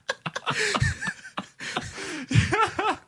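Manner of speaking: long high-pitched laughing fit; helpless laughter. Real, not acted. Host loses it at co-host's icicle joke, helpless laughter. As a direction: crack up completely.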